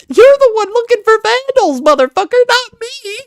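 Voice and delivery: high, loud, furious shout at the screen; angry yell. Real, not acted. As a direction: really let him have it, voice up high. Ranting at the vigilante clip.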